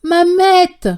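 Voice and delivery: loud, high call across the house; loud high shout of a name. A narrator's reading. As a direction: call her from far off. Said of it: "en appelant".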